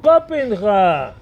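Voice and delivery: long loud drawn-out call of the name. Acted. Boss summoning his clerk, loud slow call. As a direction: call him in from the next room.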